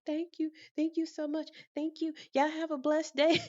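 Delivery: high, bubbly, overflowing thank-yous; effusive blessing. Retold or imitated. Blessing the office staff after the waived penalty, effusively. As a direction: bless everyone out of pure relief. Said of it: "I started blessing people".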